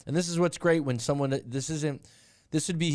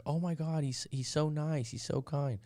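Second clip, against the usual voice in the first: higher, soft, gushing imitation; mock gushing women; 4 semitones higher, 5 dB quieter than usual. Imitating women who mock her husband, lightly gushing. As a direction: lightly mimic the fawning women. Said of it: "some other women are like".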